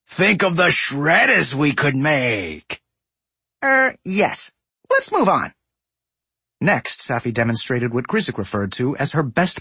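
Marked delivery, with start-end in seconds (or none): 0.1-2.8 gruff smug trade prince; raspy goblin boss. A narrator's reading. Voices Gallywix. 3.6-5.5 awkward goblin woman. A narrator's reading. Voices Safi, awkward.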